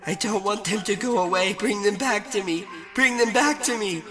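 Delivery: high, loud wailing plea; screaming children. A narrator's reading. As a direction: wail like a terrified child. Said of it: "They all screamed out".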